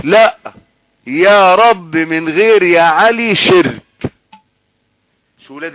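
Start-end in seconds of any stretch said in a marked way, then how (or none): 0.0-3.8 louder, slow, insistent mock argument; imitated stubborn opponent. Preacher mimicking a man who argues harder, mock-obstinate. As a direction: dig in and stretch it out as a mimicked objector. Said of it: "بل يجي واحد يعاند أكتر ويقول لك".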